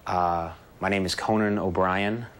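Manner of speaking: low, hesitant intro to a casting panel; nervous audition voice. Acted. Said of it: "I actually have my audition tape".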